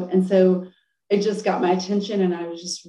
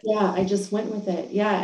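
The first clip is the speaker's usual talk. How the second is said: ordinary talk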